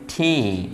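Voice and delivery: ঠি drawn out, with a puff of air after T; aspirated T. Shown as a demonstration. Teacher fixing a common mistake, long aspirated ঠি. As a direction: say a slow, low ঠি with breath released. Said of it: "এই উচ্চারণটা সবাই ভুল করে".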